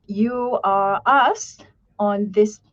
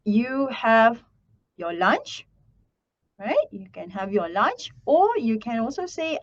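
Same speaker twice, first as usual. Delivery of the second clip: ordinary talk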